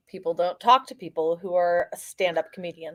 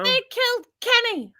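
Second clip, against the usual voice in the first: high put-on cartoon voice, much higher; South Park catchphrase. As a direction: squeak it like the cartoon kid.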